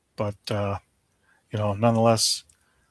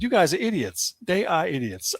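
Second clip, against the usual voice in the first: exasperated, higher-pitched rant; real irritation, voice pitched up; mad; 6 semitones higher than usual. Real, not acted. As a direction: vent indignation without shouting. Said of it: "I am so mad at this".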